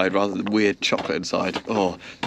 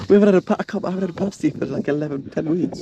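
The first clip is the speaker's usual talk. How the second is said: excited; 8 semitones higher, faster than usual. Real, not acted. Excited after laughing.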